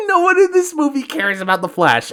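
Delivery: high, loud and helpless laughter through the words; laughing in disbelief. Real, not acted. Ranting about how nobody cares about the Flash, laughing incredulously. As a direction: crack up while saying it.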